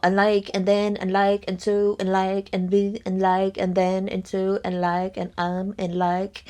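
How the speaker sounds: mock valley girl stringing likes together. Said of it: "She just sounds like a valley girl".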